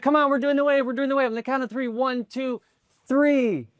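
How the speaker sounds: high, insistent rallying cry; urging the crowd. Retold or imitated. Kid pushing fans to do the wave, urgent high call. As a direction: plead and count off at a high pitch.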